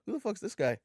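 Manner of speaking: high-pitched, incredulous question; the only clueless one in the crowd; baffled disbelief. Retold or imitated. Retells clueless reaction amid excited crowd.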